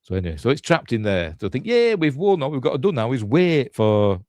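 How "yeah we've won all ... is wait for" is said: high-pitched cheer of victory; mock celebration. Survivors celebrating a trapped monster, mock-triumphant. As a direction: cheer as if it's all over.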